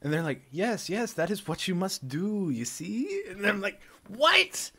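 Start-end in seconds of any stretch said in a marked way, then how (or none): 0.5-3.2 high, slow, patronizing voice; mock voice of the internet. The internet lecturing him in a high, slow, patronizing voice. 4.1-4.7 high, loud incredulous yelp; shocked what. Retold or imitated. Stunned by the internet's advice, a high, loud yelp.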